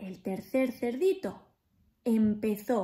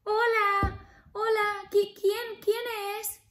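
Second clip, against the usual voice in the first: much higher pleading piggy voice, half sung; squeaky little pig; 10 semitones higher, 3 dB louder than usual. Acted. Little pig refusing the wolf at the door, high and sing-song. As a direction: squeal it out in a tiny frightened voice. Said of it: "ay, el lobo".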